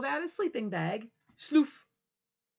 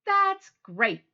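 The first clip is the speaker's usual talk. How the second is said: higher, louder cheery mom; mother's voice; 10 semitones higher, 10 dB louder than usual. Acted. Mother praising Froggy, higher and louder. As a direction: sound pleased for him.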